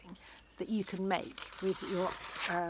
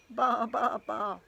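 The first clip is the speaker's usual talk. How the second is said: loud bleating baa; sheep baa; 3 semitones higher, 10 dB louder, much slower than usual. Acted. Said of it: "said the fat red hen".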